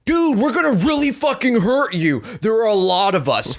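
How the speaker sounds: high, insistent warning; plain-worded threat. Retold or imitated. Warning a stranger he is outnumbered, earnest plain threat. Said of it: "very basic terms".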